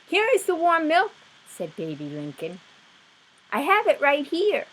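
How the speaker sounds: high, gentle timid voice; sweet Baby Lincoln. Acted. Younger sister suggesting milk, high and sweet.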